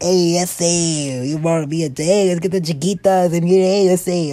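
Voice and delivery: stretched-out, put-on Mexican accent. Shown as a demonstration. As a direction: play up a Mexican character's accent. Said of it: "I'll be like the Mexican".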